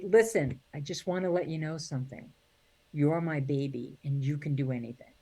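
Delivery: soft, slowing into a quiet reassurance; a mother quietly reassuring her grown daughter; tender mother. Retold or imitated. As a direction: comfort your child gently.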